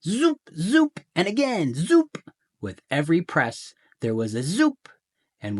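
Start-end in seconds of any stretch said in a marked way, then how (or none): 0.0-1.0 high, loud cartoon zoops; squeaky rising zoops; zoop sound effect. 1.8-2.2 high, loud cartoon zoops; squeaky rising zoops; zoop sound effect.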